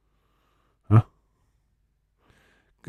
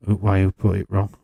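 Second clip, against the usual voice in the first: ordinary talk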